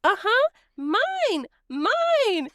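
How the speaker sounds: put-on high, slow little-kid voice; grinning, expectant toddler. Little cousin expecting the french fry, grinning high voice. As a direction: imitate a gleeful child sure of the treat. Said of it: "grinning, like he thinks he's gonna get it".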